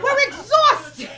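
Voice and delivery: put-on high, loud shrieking old lady rant; screaming grandmother. Grandmother berating her comic grandchild after a bombed show, high screaming rant. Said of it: "Screaming on the top of her".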